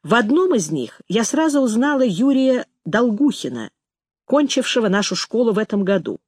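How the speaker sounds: usual speaking voice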